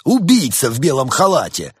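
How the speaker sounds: outraged public accusation; shouted accusation. A narrator's reading. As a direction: cry out the charge to everyone. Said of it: "кричал делец".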